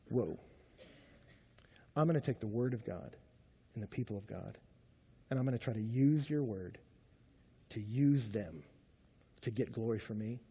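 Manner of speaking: hushed, low and halting; quiet, grave self-reproach; sobered realization. Retold or imitated. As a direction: confess it softly, with long pauses. Sobered realisation after loud self-mockery.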